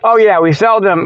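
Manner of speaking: normal talking voice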